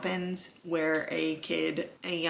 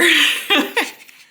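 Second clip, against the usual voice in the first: bright burst of high, loud laughter; real laugh; 10 semitones higher, 10 dB louder than usual. Real, not acted. Laughing at herself after telling herself to calm down, high loud laugh. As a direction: laugh it off at yourself.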